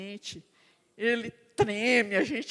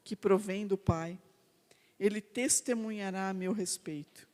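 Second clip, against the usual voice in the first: sermon reading; 4 semitones lower than usual. Acted. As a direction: lay each phrase down gravely to the congregation. Slow preaching, scripture and exhortation.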